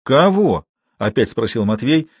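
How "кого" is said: higher, louder challenging question; sharper repeated question. A narrator's reading. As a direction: demand it with raised pitch. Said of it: "лицо его вдруг стало злобно".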